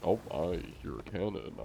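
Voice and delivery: ordinary delivery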